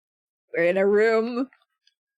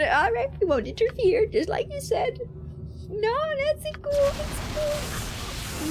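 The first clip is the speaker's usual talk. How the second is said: much higher, softer timid voice; meek high squeak; 12 semitones higher, 3 dB quieter than usual. Acted. Party cowering before the demon, squeaky and timid. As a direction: cower and agree nervously.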